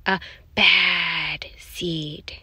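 very slow, whispery stretch on each word; drawn-out menacing refrain. A narrator's reading. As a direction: draw it out slowly and ominously. Dramatic refrain of villain narrator.

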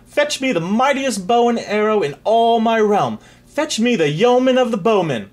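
loud, high royal command; booming command. Acted. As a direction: boom it across the court. King commands loudly.

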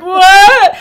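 high, loud incredulous yelp; shocked what. Retold or imitated. Sobbing woman stunned by her friend's compliment, loud shocked yelp. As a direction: blurt it out in disbelief. Stunned reply during ugly cry.

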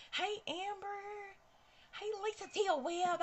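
high, slow, sing-song hello; sing-song greeting. Real, not acted. Greets viewers Amber and Lisa in a sing-song. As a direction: call out each viewer's name, singing it.

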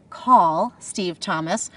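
slow, deliberate spoken command. Shown as a demonstration. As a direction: say it slowly and clearly to the car. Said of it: "All you have to remember is to say".

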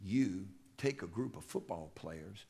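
low and soft, barely above a murmur; hushed retold coach; quiet, soft voice. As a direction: speak it softly, like a coach confiding. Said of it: "he spoke real quiet, real soft".

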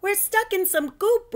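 high, loud cry; boy stuck in sticky nectar; yelled. Acted. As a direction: shout for help. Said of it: "Ralphie yelled".

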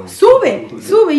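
high excited urging with a laugh; giggly eager summons; urgent sube. Retold or imitated. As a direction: call her up, laughing and high. Coworker urging her upstairs.

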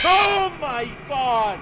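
slow startled shout. Real, not acted. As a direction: yell in shock. Jump scare.

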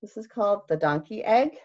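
ordinary delivery

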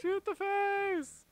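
high, slow, loud command; high excited squeal. Pretend. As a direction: squeal it out eagerly.

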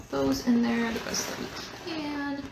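normal talking voice

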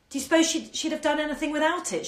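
ordinary delivery, nothing marked

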